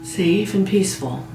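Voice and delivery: slow, measured meditation voice with long pauses; guided meditation. Real, not acted. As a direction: guide a listener gently toward sleep, phrase by phrase. Leads a guided sleep meditation.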